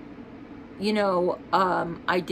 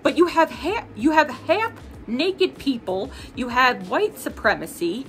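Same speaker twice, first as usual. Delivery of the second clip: furious tirade building to an outburst; heated rant; 5 semitones higher than usual. Real, not acted. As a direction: let the outrage pour out at the app. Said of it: "I know I got a little heated there".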